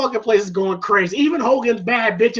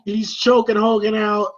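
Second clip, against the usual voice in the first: ordinary delivery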